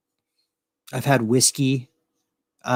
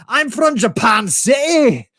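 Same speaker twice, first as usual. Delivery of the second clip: much higher, slow shouted Scottish brogue; 12 semitones higher, 4 dB louder, slower than usual. Pretend. Fake Scot names his city, shouted Scottish brogue. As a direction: belt it out as a hammy Scot. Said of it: "talking, like, Scottish".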